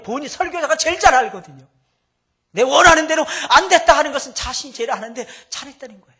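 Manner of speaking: high loud insistent pulpit voice; forceful sermon shout; loud preaching. Acted. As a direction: drive the point home full force.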